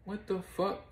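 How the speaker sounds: high, breathy mock shock; stunned, can't believe it; shocked disbelief. Destiny reacting in shock, voiced high. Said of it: "Destiny was like".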